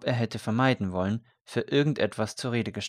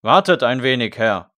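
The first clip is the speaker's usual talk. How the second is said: loud call through a shut door; 2 semitones higher, 11 dB louder than usual. A narrator's reading. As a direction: call to the man outside, much louder. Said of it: "rief der Pfarrherr".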